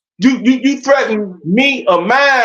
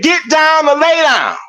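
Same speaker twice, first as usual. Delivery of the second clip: higher, commanding quote; retold gunman's order; 6 semitones higher than usual. Man retelling what armed attackers ordered, commanding. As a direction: imitate an armed command, pitched up.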